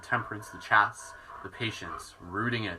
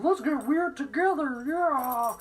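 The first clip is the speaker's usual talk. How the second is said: much higher playful dog voice; voicing the dog; 14 semitones higher, slower than usual. Owner voicing her dog joining the laughter yoga. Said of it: "Dallin's like".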